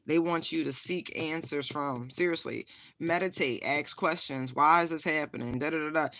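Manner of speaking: usual speaking voice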